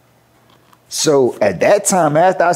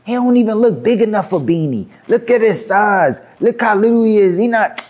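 dismissive trash talk; 7 semitones higher than usual. Retold or imitated. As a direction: retell it as an incredulous, scornful put-down. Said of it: "He start talking like that".

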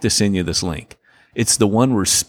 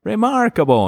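high-pitched catchphrase reply; put-on little kid voice; imitating TV kid; 12 semitones higher, 3 dB louder than usual. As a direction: answer high, like an oblivious child. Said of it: "And the kid goes".

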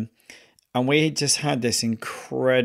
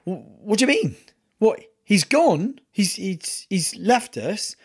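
higher, halting disbelief; retold shock; 8 semitones higher than usual. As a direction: replay your own stunned confusion. Himself stunned in retold story.

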